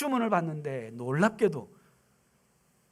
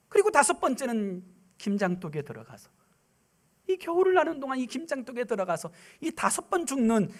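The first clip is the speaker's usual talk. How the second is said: usual speaking voice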